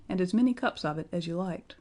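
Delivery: ordinary delivery, nothing marked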